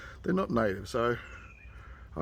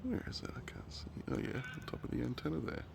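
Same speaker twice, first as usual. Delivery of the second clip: whisper; 3 semitones higher, 10 dB quieter than usual. Real, not acted. Searching for the bird.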